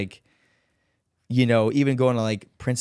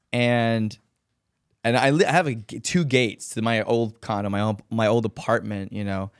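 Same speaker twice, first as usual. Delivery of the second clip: ordinary delivery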